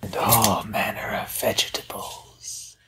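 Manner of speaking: hushed whisper. Acted. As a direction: whisper so nobody hears. Hobbits hiding, whispering.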